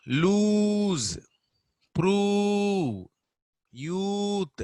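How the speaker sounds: lose, prove, youth with a high, stretched oo; long oo. Shown as a demonstration. Teacher drills long oo words, high and stretched. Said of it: "it is a long vowel".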